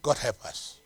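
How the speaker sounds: normal talking voice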